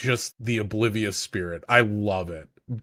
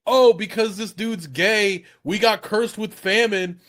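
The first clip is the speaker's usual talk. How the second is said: high, slow mock-naive whine; mocking dumb villager; 9 semitones higher, 3 dB louder than usual. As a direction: play the villagers as clueless fools. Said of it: "they're like".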